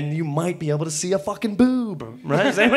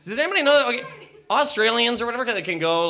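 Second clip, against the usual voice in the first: normal talking voice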